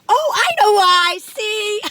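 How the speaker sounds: high, loud, slow cry of discovery; relieved shout. Real, not acted. Relief at finding the cause.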